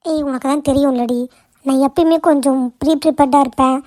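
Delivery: usual speaking voice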